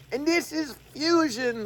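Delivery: mock British TV presenter in Cockney; a sketchy Cockney impression announcing the topic; Cockney accent. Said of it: "I can't even do my British Cockney accent".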